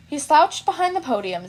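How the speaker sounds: ordinary delivery, nothing marked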